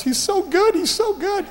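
very high squealed imitation of an overcome girl; squeaky, overwhelmed praise, acted out; imitated gushing. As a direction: gush in a mock high-pitched voice. Acts out overwhelmed girl from Panama.

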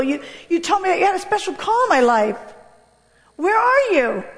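high pleading cry to God; desperate plea. Retold or imitated. A frightened girl on the street crying out to God, desperate high plea.

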